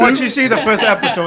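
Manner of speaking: chuckling while teasing about the first episode; laughing. Real, not acted.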